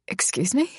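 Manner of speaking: breathless whispered croak; a shocked whisper. Acted. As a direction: barely get the words out. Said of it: "I croak".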